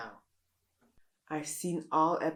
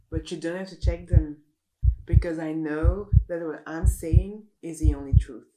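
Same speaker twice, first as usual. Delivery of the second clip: louder, self-assured proclamation; louder, smug pundit certainty; 11 dB louder than usual. Acted. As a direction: declare it as the only truth. Smug claim of absolute truth.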